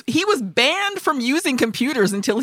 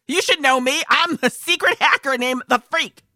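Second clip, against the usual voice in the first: smug hacker; 6 semitones higher than usual. Retold or imitated. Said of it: "Why are you just like".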